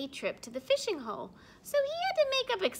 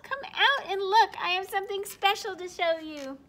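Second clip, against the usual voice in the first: much higher, louder excited call; 8 semitones higher, 9 dB louder than usual. Acted. Snowy calling Mommy out to see, loud call. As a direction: call across the yard to Mommy. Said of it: "he called".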